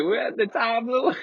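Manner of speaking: high-pitched mock stoner cry; giddy tripping voice. Repeats the tripping line.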